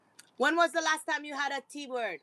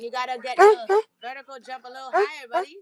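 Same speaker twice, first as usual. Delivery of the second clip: coaxing the dog; 8 dB louder than usual. Real, not acted. Coaching the dog to jump.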